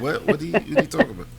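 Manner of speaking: high, rapid baffled questioning; mock bewilderment. Baffled visitor answering New Yorkers, high quick mock confusion. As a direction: blurt it high and fast, acting lost. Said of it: "And you're like".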